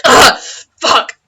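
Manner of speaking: coughing, pained curse. Acted. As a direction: cough it out hurt. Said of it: "like, coughing".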